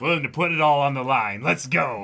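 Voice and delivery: fired-up rallying call; excited hype. Real, not acted. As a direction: shout it like you're charging into battle. Hyping each other up loudly.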